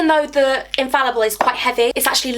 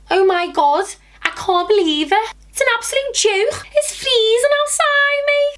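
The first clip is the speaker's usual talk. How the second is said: Liverpudlian; 11 semitones higher than usual. Shown as a demonstration. Vlogger shows off the accents she can do, high Liverpudlian. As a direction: put on a high-pitched Scouse accent, stretching the end. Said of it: "I can do Liverpudlian".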